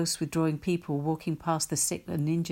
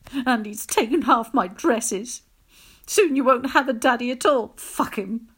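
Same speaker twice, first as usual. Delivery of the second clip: louder, higher, furious rant; bitter rage; 6 semitones higher, 8 dB louder than usual. Acted. Abandoned wife raging to neighbour and daughter, furious. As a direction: spit out the grievance. Mother raging at departed husband.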